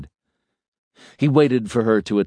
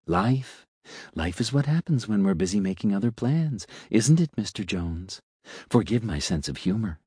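softer, silky musing machine voice; philosophizing elevator; 2 semitones higher, 4 dB quieter than usual. Acted. Elevator lecturing Mister Jones on life, soft and smug. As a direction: savour each aphorism like a smug little philosopher.

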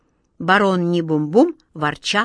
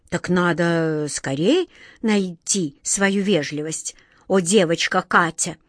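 ordinary delivery

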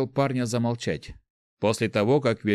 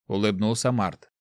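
usual speaking voice

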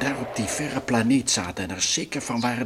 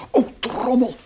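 higher, louder startled cry; 11 semitones higher, 6 dB louder than usual. Acted. Frans startled as armed men approach. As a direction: jolt at the sight of the spears. Sees armed natives coming.

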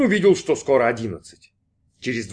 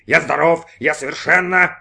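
louder, emphatic insistence; protesting exclamation; 4 semitones higher, 6 dB louder than usual. A narrator's reading. Brin insists he is well, louder protest. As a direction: insist loudly until cut off. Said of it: "воскликнул Брин".

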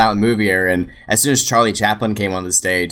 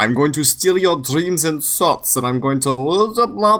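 slow, villainous mock German accent; 4 semitones higher, slower than usual. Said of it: "love your German accent".

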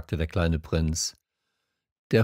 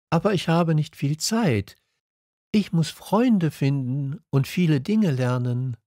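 higher, earnest prince voice; little prince; 8 semitones higher than usual. A narrator's reading. Little prince short of time with the fox, higher voice. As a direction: explain it as a small prince, pitched up.

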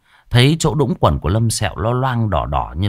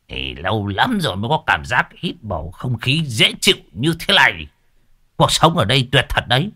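put-on old man savoring; 5 semitones higher than usual. Old man enjoys mountain air.

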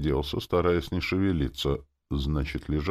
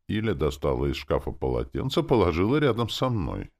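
ordinary delivery

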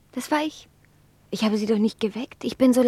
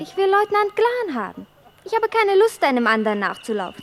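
insistent; 7 semitones higher, 5 dB louder than usual. Acted. Edwarda insists loudly on Glan.

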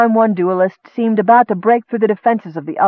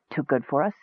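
soft, hissed taunt; 2 semitones lower, 9 dB quieter than usual. Acted. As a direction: hiss it like a serpent mocking him. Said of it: "Silverlin hissed".

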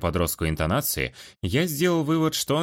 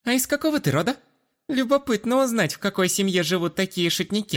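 put-on high, coquettish girlish voice; 13 semitones higher than usual.

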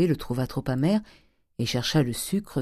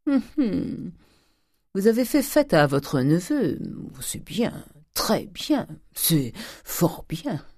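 higher voice, drawn-out measured approval; sly old miser; 5 semitones higher, slower than usual. Acted. Grandet finding his nephew being feasted, slyly approving. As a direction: approve with pointed, lingering hums and pauses.

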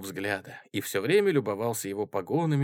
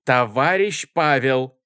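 loud, slow, raised address; calling out; 4 semitones higher, 9 dB louder, slower than usual. A narrator's reading. Chairwoman stopping a comrade, calling out. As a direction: call him firmly to attention.

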